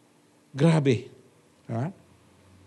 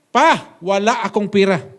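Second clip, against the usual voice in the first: curt refusal; 2 semitones higher, 8 dB louder, slower than usual. Retold or imitated. Daughter-in-law refusing father.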